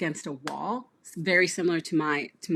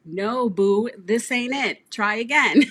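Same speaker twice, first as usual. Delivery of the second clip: higher and louder, playful scolding with a laugh; the universe, voiced as a sassy friend, scolding through a laugh; 6 semitones higher, 8 dB louder, slower than usual. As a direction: talk down to them like a sassy friend. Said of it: "slap you across the face and be like".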